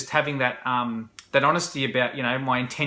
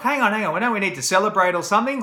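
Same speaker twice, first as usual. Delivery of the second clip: retold excitement, higher, louder and quick; a mildly replayed rush of excitement, quicker; excited hang on; 6 semitones higher, 4 dB louder, faster than usual. To his buddy after getting her number, excited and fast. Urging buddy to celebrate success.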